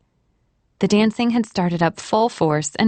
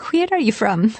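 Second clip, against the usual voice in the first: put-on Irish accent; 3 semitones higher than usual. A narrator's reading. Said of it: "Her Irish accent".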